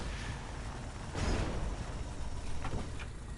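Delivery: a long breathy laugh, nearly silent; quiet long laugh. Real, not acted. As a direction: let a silent laugh roll on. Long laugh after motherfucker.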